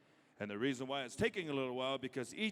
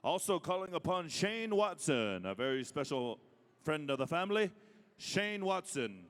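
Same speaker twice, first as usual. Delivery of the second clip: powwow emcee; 3 semitones higher than usual. Acted. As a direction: stretch the name for the arena. Calling names at a powwow.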